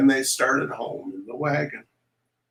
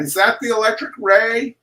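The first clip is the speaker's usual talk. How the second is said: cried out; 9 semitones higher, 7 dB louder than usual. A narrator's reading. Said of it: "little Louis cried".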